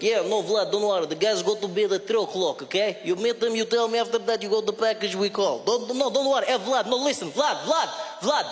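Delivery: mock Russian accent on a phone call; Russian-accented English. Russian guy on the phone to Vlad, mock accent. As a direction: play a Russian thug setting up a deal. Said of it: "he was like".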